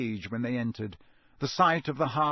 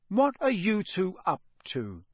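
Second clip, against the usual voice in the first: sharp, raised question from mother; 6 semitones higher than usual. A narrator's reading. Mother to twins, sharp suspicious question. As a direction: demand it sharply, higher. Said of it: "said Missus Weasley sharply".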